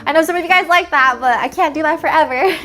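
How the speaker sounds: playful scolding. Real, not acted. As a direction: wag a finger at the fans, half laughing. Playfully scolding her viewers.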